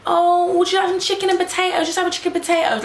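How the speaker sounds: high, fast, mocking sing-song; mock friend voice. Friend's advice mocked again.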